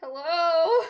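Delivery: loud, drawn-out hello with a rising end; calling out. Real, not acted. Streamer checking if the lagging chat hears her, calling out.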